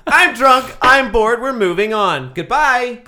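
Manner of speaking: loud, emphatic announcement; loud sign-off. Pretend. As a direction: call it out and shut the topic down. Loudly shuts the topic down.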